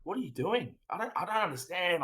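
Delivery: put-on high, baffled older man's outburst; confused South African coach. Older cricket watcher baffled by the batting, high confused outburst. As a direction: act out the bewildered older coach. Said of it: "and he's like".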